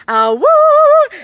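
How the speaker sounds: imitated loud, high howl; long, high "woo" of a dog. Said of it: "we'll hear a dog go".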